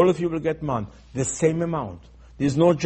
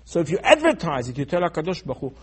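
usual speaking voice

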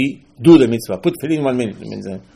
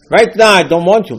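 raised, forceful rebuff; loud refusal; 6 semitones higher, 8 dB louder than usual. Acted. The Satan loudly refusing Yaakov in their fight. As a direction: shove him off with your voice. Acted quarrel, Satan's reply.

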